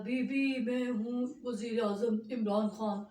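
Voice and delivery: slow, lightly put-on dignified voice; Imran Khan voice. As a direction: announce yourself slowly, like a prime minister. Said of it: "तो कहने लगा". (धीमी, हल्की नकल वाली प्रधानमंत्री की आवाज़)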